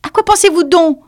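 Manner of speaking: high, loud indignant mouse; scolding squeaky mouse; stern reproach. A narrator's reading. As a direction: demand it severely, voice way up. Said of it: "d'un ton de voix sévère".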